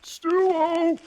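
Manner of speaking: high, drawn-out call; calling back. Acted. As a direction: holler back on a high note. Calling out to each other.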